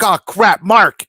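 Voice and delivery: high retold cry of sudden alarm; mock panic. Realizing he's late for Mark's show, comic panic.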